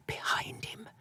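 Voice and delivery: barely-there whisper; a last ghostly whisper; whispered echo. A narrator's reading.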